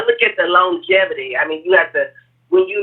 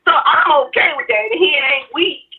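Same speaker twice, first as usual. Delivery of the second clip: ordinary delivery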